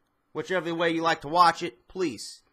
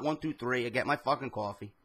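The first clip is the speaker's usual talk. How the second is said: normal talking voice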